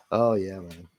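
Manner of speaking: normal talking voice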